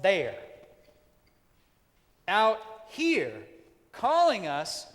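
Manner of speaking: loud, high, building preacher's cry; preaching at full force. Acted. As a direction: preach it out to the back row. Rising sermon climax.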